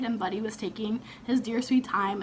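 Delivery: ordinary talk, nothing marked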